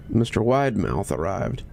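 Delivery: usual speaking voice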